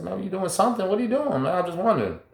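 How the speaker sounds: high, curious mock-casual prodding; pressing question. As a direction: push him to admit it, half amused. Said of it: "I said".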